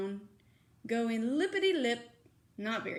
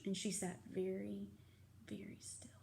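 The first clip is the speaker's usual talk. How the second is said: soft whisper, fading away; 3 semitones lower, 14 dB quieter than usual. A narrator's reading. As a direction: breathe it out, very still. Cat sits very still.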